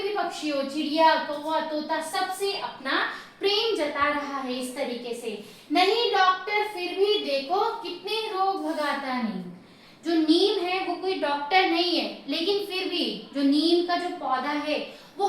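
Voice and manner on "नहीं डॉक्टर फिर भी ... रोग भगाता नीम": lifted, higher verse-reading voice; children's poem read out to a class; poem recitation. Shown as a demonstration. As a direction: recite high, then drop on the last word.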